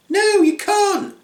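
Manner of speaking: very high, slow squeaky refusal; defiant little pigs. Acted. Little pigs refusing to let the wolf in, high slow squeak. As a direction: squeal a stubborn no.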